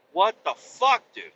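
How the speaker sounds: exasperated protest. Retold or imitated. Customer told truck still isn't done, exasperated. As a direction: recreate a high-pitched, exasperated protest. Retold protest at another delay.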